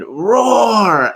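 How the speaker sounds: put-on high, loud little-kid roar at a lion; toddler roar. Dad retelling his daughter at the zoo, high kiddie roar. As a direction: roar like a one-year-old pointing at a lion. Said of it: "and she said".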